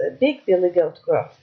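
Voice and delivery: ordinary delivery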